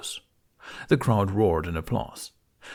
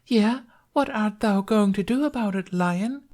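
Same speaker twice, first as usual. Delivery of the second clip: high falsetto challenge; goading damsel; 14 semitones higher, 3 dB louder than usual. A narrator's reading. Bunny as Lunette dares the lion, goading high. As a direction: taunt in a piping stage voice.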